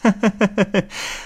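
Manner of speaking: put-on high bright tittering laugh of a playful young wife; teasing laugh. Young wife bursts in on the lovers, high teasing laugh. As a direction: laugh as you sweep in, teasing. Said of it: "一进门就说".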